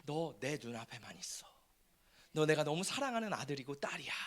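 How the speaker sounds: soft then whispered tenderness; God whispering love to his child. Acted. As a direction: whisper it like a loving parent. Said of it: "하나님이 원하시는 복은 뭐예요?".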